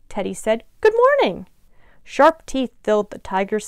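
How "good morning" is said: very high, loud singsong hello; friendly greeting. A narrator's reading. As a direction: greet them brightly, pitch way up. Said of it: "Not wanting to scare anyone".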